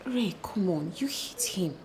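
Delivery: soft whisper of disbelief; whispered reproach. Acted. Sister reproaching her brother, whispered reproach. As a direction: hush him, reproach gently.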